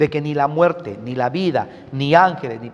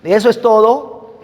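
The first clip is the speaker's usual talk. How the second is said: higher, louder probing question; Peter questioning; 7 semitones higher, 7 dB louder than usual. Retold or imitated. Peter confronting Ananias and Sapphira, louder and higher. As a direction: press them, high and sharp. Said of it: "Y Pedro dice".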